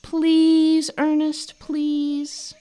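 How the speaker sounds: high, loud begging; pleading. Acted. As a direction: beg your friend with everything. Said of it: "Kino pleaded".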